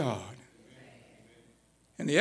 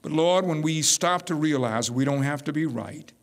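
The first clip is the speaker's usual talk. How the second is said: lower, steady prayer voice; closing prayer; 4 semitones lower than usual. Acted. Pastor praying over the congregation, lower and calm. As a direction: speak to God plainly and humbly.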